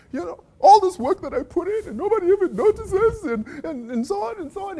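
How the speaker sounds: high, whining complaint voice; mock the moaning of an unappreciated worker; whiny self-pity. Speaker mocking workers who feel sorry for themselves, high whiny voice.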